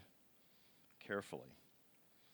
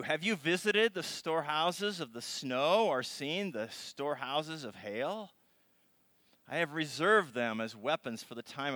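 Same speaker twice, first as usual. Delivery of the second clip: loud, measured reading of God's speech; dramatic scripture reading; 6 semitones higher, 9 dB louder than usual. Acted. Preacher reading God's challenge to Job aloud.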